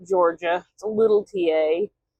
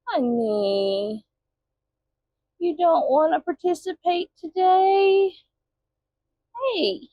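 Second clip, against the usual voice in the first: high pet talk; 10 semitones higher, slower than usual. Real, not acted. Owner coaxing the dog in a high voice. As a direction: coax the dog sweetly.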